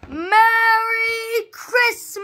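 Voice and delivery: high, loud and slow, stretched out; guard shouting through cupped hands; shouted. Acted. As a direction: call it from a speeding train. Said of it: "he shouted".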